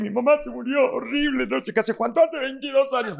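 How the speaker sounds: high, put-on mournful voice of someone still grieving; mock a person still crying over an old loss; imitating a grieving person. Imitating someone still mourning after decades, high and whiny. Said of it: "hay gente que dice".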